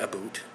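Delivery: "about" said as "a-boot"; mocked Canadian ABOUT. Shown as a demonstration. Denying the Canadian myth to viewers, "about" as "a-boot". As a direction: turn the ending of "about" into "boot". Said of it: "We don't pronounce it about".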